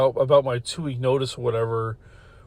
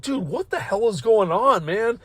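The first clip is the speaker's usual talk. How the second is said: raised-pitch incredulous question; retold bewilderment; 7 semitones higher than usual. Coworker at back door walking into chaos, imitated bewilderment.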